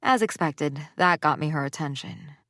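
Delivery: ordinary delivery, nothing marked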